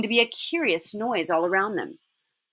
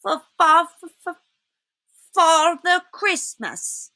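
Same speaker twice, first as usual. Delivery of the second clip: higher, repeating "for", slow on "Father Christmas"; frightened stammer; 7 semitones higher than usual. Acted. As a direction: stammer it out in fear. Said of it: "stammered the fox".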